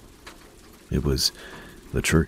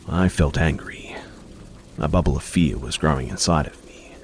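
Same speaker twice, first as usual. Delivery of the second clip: ordinary delivery, nothing marked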